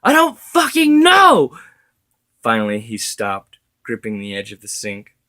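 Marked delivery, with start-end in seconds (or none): 0.0-1.6 much higher, much louder cry of despair; anguished yell. A narrator's reading. Widower screaming at his dead wife in despair. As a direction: hurl the question at her, breaking.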